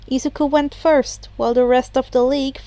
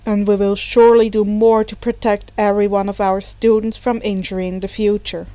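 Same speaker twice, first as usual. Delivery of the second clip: a lightly lower, steady teacher voice; Aizawa's voice; 4 semitones lower than usual. A narrator's reading. Aizawa answers reporters about his student, lightly lower and measured. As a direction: answer the press calmly, a touch lower.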